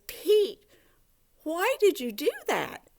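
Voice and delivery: high-pitched, upset protest. Acted. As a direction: act out a hurt, upset complaint at Pete. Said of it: "Penelope gets upset".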